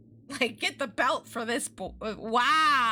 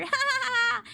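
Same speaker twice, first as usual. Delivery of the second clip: wild laughter; 14 semitones higher, 3 dB louder than usual. A narrator's reading. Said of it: "Lindy burst into wild laughter".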